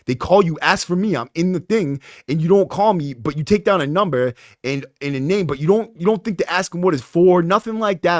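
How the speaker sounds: frustrated tirade at the staffer; aggravated rant. Retold or imitated. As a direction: let the irritation pour out. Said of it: "So I was just getting aggravated".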